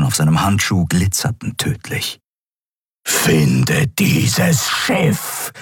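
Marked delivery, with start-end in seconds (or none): 3.1-5.5 very slow, low distorted emperor; menacing, warped dark lord. Acted. Emperor orders. (verzerrte, tiefe Herrscherstimme)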